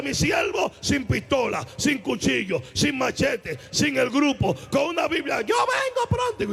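God sending his servant out with only a Bible. Retold or imitated. God commands in testimony.

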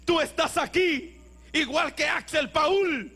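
predica a pleno pulmón, con gritos; predicación ferviente. Acted. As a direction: exhorta con fuerza a la congregación. Predica con fuerza al final. (el predicador anima a los hermanos, a gritos)

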